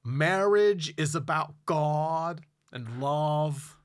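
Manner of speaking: slow, stretched-out mock sermon; lingering preachy pronouncement. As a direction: imitate a wedding officiant, drawing out the key words. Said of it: "and [name] said".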